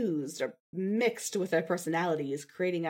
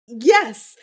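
louder, higher burst of joy; relieved yes; 4 semitones higher, 15 dB louder than usual. Acted. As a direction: blurt it out in relief.

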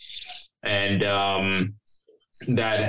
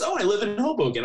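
lifted, bright girly imitation; mock high Carly voice; 11 semitones higher, faster than usual. Carly offering her place, voiced high and sweet. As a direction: pitch it up, sweet and eager.

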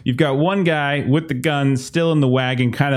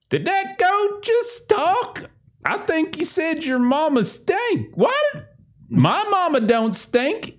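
much higher, slow yokel voice; dumbfounded farmer; slower than usual. Acted. Farmer can't believe the goat insulted his mama.

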